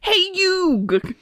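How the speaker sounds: high, cartoonish Brooklyn accent. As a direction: Brooklyn cartoon teen, cocky. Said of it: "do a Brooklyn accent".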